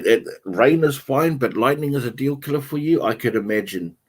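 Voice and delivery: ordinary delivery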